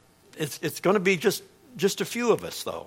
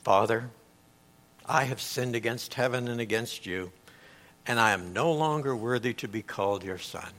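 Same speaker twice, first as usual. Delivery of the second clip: quieter, lower, hushed confession; a son facing his father, quiet and lower; humble plea; 8 semitones lower, 7 dB quieter than usual. Retold or imitated. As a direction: say it broken and humbled, softly. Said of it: "the son begins his speech".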